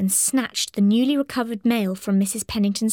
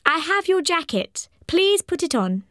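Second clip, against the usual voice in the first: high, raised motherly call; calling kindly old lady; 7 semitones higher than usual. Acted. Mrs Pennington calling to Tommy.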